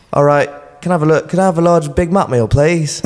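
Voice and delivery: mock a lad trying to order clearly; imitating mate ordering. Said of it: "he had to be like".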